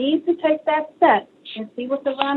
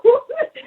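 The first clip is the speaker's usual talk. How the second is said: a high, loud burst of delighted laughter; 13 semitones higher, 5 dB louder than usual. Real, not acted. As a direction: laugh out loud with joy at the memory. Excited retelling of book sale.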